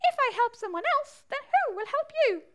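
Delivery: high, quick squeaky bat. Acted. Bat questions the mole's advice, high quick squeak. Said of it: "said the bat".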